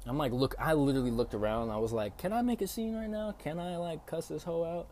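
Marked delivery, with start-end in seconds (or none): 2.2-4.9 high-pitched eager self-questioning; retold itching to explode. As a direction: ask it high, itching to make a scene. Weighing making a scene, retold.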